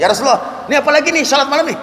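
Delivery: quick, high-pitched query; puzzled questioning. Acted. Followers ask the Prophet about night prayer, puzzled questioning. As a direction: blurt the question high and fast.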